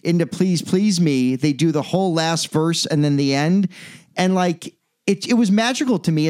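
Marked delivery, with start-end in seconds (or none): none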